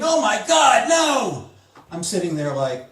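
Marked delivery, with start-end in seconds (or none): 0.0-1.5 high, loud mock scream; screaming in horror. Everybody at the movie's climax, screaming in horror. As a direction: cry out in drawn-out mock horror. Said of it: "everybody's screaming".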